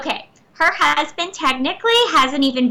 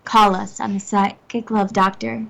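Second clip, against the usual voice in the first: put-on phone-line voice, unhurried. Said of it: "going to do her phone voice".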